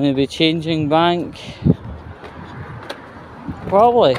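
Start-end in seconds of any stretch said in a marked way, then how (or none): 3.7-4.2 higher, louder "Probably"; a cheeky loud "Probably"; emphatic probably. Real, not acted. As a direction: pop the answer out with cheek. Answer to own question.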